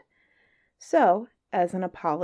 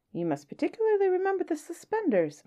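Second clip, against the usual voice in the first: playful aside; 12 semitones higher than usual. A narrator's reading. Narrator aside to listener.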